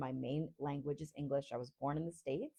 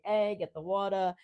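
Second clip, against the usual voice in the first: Rhode Island accent; 3 semitones higher, 4 dB louder than usual. Shown as a demonstration. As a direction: drop the R's like a Rhode Islander. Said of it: "I could turn it on for you".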